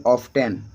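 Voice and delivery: "ऑफ्टन" with the T sounded; common pronunciation. Shown as a demonstration. Teacher showing how most people pronounce it, T sounded in "ऑफ्टन". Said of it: "अधिकतर व्यक्ति pronunciation करेंगे".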